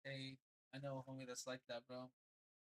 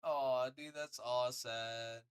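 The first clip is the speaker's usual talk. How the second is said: slow, louder drawn-out aw of pity; 8 dB louder, much slower than usual. Real, not acted. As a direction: stretch it out in sympathy.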